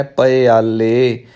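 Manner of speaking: ਪਿਆਲੇ slowly as "pa-i-aale"; open pappa. Shown as a demonstration. Teacher summing up the rule, slow ਪਿਆਲੇ as "pa-i-aale". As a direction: draw out ਪਿਆਲੇ with a short a after p. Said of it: "ਈੜੀ ਨੂੰ ਸਿਹਾਰੀ ਲਾ ਕੇ".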